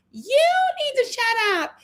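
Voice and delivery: high, drawn-out imitation of an outraged woman; mock angry yell. Host imitating Kelly confronting Portia, mock angry yell. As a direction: mimic her telling someone off, slow and high-pitched.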